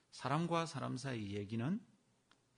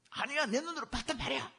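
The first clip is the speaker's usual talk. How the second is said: mock insistence; 13 semitones higher, 3 dB louder than usual. Imagined gossip insisting.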